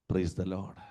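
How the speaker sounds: usual speaking voice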